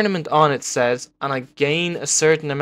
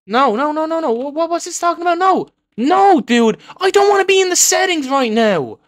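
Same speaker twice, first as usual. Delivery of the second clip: very high-pitched frantic protest; panicked frustration; 12 semitones higher, 3 dB louder than usual. Real, not acted. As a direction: wail as the menu traps you mid-game. Stuck in settings mid-game.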